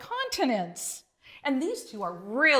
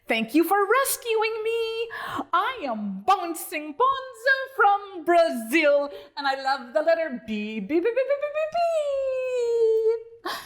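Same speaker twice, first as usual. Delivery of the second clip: squealing frog; 9 semitones higher than usual. A narrator's reading. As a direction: squeal out your thanks, bouncing. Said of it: "he squealed".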